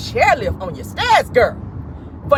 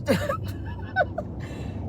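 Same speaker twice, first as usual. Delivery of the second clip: high squealing laugh; 11 dB quieter than usual. Real, not acted. Laughing hard at own joke.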